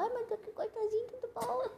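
put-on high, cooing baby talk. Shown as a demonstration. Shows her friend how she baby-talks, in high baby voice. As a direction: coo it like talking to a puppy. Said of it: "assim que eu falo com vozinha de neném".

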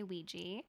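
Ouija said as WEE-jee; wee-jee pronunciation. Shown as a demonstration. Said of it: "that we both say".

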